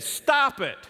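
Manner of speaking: high, loud sharp stop; loud stern command. Retold or imitated. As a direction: stop them with a loud rebuke. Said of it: "He said".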